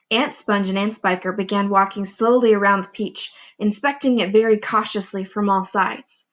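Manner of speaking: plain reading voice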